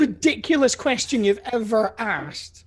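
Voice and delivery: high, drawn-out indignation; mock outrage. Real, not acted. Contestant ribbing the quizmaster over a silly question, mock outrage. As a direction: hammer out each word slowly in high protest.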